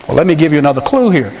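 normal talking voice

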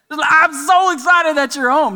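high, loud talking-dog voice; excited dog. Acted. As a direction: play a dog bursting with joy. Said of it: "If the dog could speak English".

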